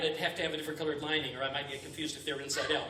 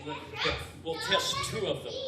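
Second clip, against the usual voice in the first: ordinary talk, nothing marked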